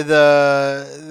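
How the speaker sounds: ordinary talk, nothing marked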